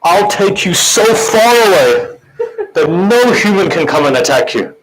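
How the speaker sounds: high, slow, wailing lament; grieving plea. Acted. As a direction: cry out the horse's promise. The horse's plea to fallen Imam.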